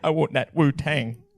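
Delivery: lightly Cockney butler voice; hint of Michael Caine; Michael Caine impression. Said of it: "I did that whole thing in Michael Caine".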